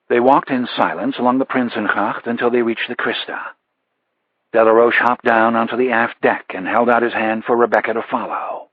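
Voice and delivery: usual speaking voice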